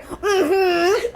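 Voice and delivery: high-pitched, drawn-out knowing hum; big mm-hmm. Acted. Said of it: "I wrote this one big".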